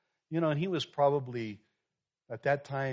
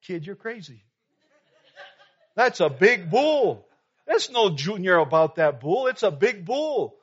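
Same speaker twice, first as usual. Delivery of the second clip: incredulous inner thought; 4 semitones higher, 8 dB louder than usual. Retold or imitated. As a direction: lean on the word bull, dumbfounded. Pastor's inner disbelief at bull size.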